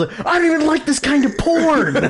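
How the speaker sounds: high-pitched mock yell; retold yell. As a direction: yell it out in a strained high voice, imitating an argument. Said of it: "and he yells".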